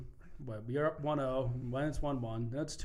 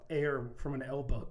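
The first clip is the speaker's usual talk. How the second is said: usual speaking voice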